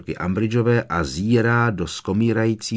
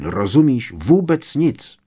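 louder, higher and slowed for emphasis; stern reprimand; 6 semitones higher, 6 dB louder than usual. A narrator's reading. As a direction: drive the point home sternly. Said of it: "vyjel na něj přísně Lupín".

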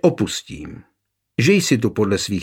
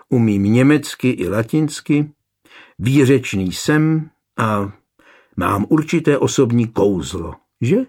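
ordinary delivery, nothing marked

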